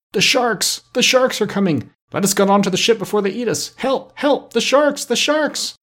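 high-pitched, panicked cries for help; great fear. A narrator's reading. Pirates in the sea crying out as sharks come, great fear. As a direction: cry out in terror, voice pitched right up. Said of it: "cried out in great fear".

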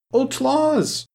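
put-on very high little-girl squeal of wonder; rapturous cry. A narrator's reading. Little Mary sees the new dolly, crying out with rapture. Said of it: "she cried, clapping her hands together with rapture".

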